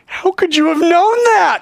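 high, loud amazed question; astonished cry. Acted. Nathanael stunned by Jesus, crying out. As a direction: blurt out in disbelief.

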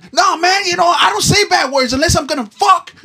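high, loud, fast mock old man shouting; grumpy old man. Grumpy old man ranting at the runner, high and loud. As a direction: rant like a cranky old guy. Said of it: "grumpy ass motherfuckers".

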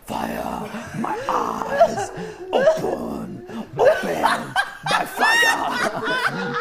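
high, slow prayer cry with laughter; preacher voice. Shown as a demonstration. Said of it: "When I talk to the Lord, I'll be like".

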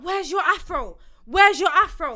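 high, loud demand, mounting on the repeat; retold shouting. Stranger shouts at woman about her hair, retold yell. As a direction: imitate a stranger yelling an accusation. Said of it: "you're now shouting at me".

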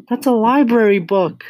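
put-on very high, loud little-girl outcry; high-pitched, loud protest; distressed child's cry. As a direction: cry out in alarm as a small girl. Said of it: "Matilda cried".

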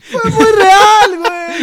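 high, loud hammed-up crying; mock sobbing. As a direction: wail it like fake tears. Mock sobbing over friend leaving.

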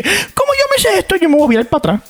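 high, smug mock thought; playing the smart kid sure of himself; cocky inner voice. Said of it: "Así que yo dije". (voz aguda y presumida)

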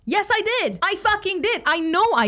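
high, exasperated yelling of agreement; exasperated outburst. Real, not acted. Vlogger answering a hater, exasperated high outburst. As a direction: throw it back at the critic, fed up. Frustrated rant about followers' comment.

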